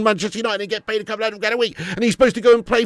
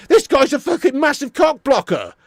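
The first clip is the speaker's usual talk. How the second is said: ordinary delivery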